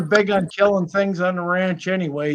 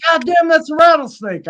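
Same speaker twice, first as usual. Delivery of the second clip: high startled mock exclamation; buddy's alarmed cry; 8 semitones higher, 4 dB louder than usual. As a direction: react as if spotting danger. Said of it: "he says".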